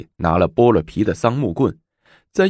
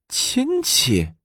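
high puzzled echo of the word; puzzled question. Acted. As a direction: ask it in real doubt. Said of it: "武则天疑惑道".

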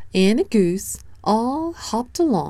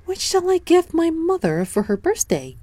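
put-on bright, much higher little boy voice; high child voice; 12 semitones higher than usual. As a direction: speak up high like an eager small boy. Child Danny wonders aloud.

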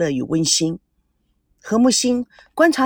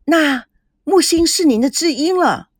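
higher, excited question; 掩饰不住的兴奋; 6 semitones higher than usual. A narrator's reading. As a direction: let the excitement slip out as you ask. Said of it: "掩饰不住内心的兴奋". (难掩兴奋地提高声调问)

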